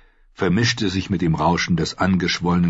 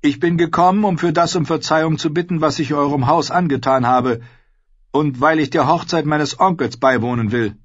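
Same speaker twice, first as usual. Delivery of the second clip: higher, louder earnest voice, hurried in places; young king's voice; 8 semitones higher, 4 dB louder than usual. A narrator's reading. Rob apologizing to the Freys for his broken promise. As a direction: speak up firmly and apologize.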